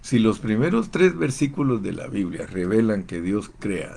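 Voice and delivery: ordinary delivery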